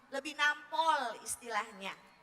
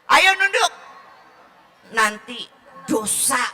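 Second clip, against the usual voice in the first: scolding order; 2 semitones lower, 10 dB louder than usual. Acted. Parent scolding a child to look down, sharp command.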